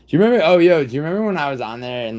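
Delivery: fast excited recall; excited outburst. Real, not acted. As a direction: blurt it out as the memory hits. Excited sudden memory outburst.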